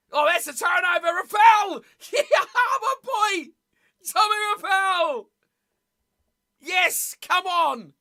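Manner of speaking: high, loud celebratory yell; cheering. Real, not acted. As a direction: roar for your favourite player. Celebrating a turnover live.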